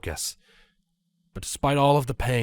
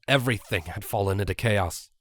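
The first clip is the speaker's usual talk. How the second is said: ordinary delivery, nothing marked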